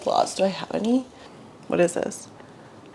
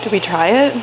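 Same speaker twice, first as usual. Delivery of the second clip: ordinary talk, nothing marked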